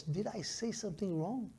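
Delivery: very soft question to himself; retold quiet self-check. Young pastor rechecking his verses, very quiet self-doubt. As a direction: drop right down, as if privately checking. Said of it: "quietly recheck my verses".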